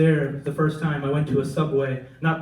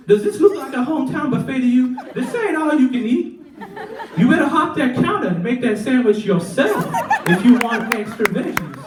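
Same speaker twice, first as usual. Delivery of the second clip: sassy worker; 8 semitones higher than usual. Retold or imitated. Comic plays a fed-up Philly Subway worker, high and sassy. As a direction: scold the customer like it's beneath her. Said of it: "look at you like".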